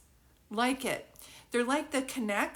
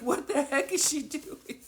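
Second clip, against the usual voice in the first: higher-pitched quote through real laughter; laughing; 4 semitones higher than usual. Real, not acted. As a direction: crack up while imitating the brother. Quotes brother while cracking up.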